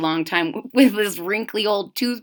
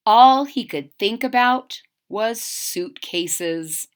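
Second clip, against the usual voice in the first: ordinary delivery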